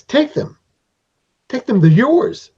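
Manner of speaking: high, eager, take them; little creature master insisting you take the gems; insistent gift giver. Acted. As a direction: push the gift on them gladly.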